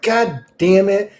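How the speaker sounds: a dragged-out imitating complaint; mock frustration. Retelling talk with God, slow mock frustration. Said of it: "And I was like".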